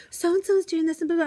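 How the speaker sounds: high-pitched mock tattletale child; tattling schoolgirl. Girl student tattling to her teacher, tattling child voice. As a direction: rattle it off in a high kid voice. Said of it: "one of my girls comes over".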